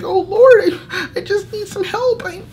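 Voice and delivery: high, wailing hammed-up cry to the Lord; jokingly acting out a desperate prayer, crying high; mock crying plea.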